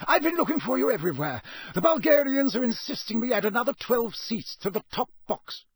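fast, high-pitched testy complaint; impatient. A narrator's reading. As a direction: press your grievance with a bite of impatience. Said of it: "a bite of impatience in his voice".

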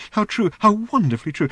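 high, gushing robot voice; rapturous robot. A narrator's reading. The robot gushing at Ford's remark, high and rapturous. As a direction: gush over every word.

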